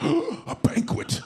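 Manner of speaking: drawn out very slow and high, hammed-up wonder; mock awe. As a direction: say it like bread is a feast. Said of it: "the family's like".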